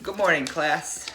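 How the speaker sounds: plain reading voice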